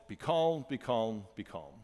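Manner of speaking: soothing, fading away to a hush; calm, fading. Shown as a demonstration. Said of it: "So practice being calm".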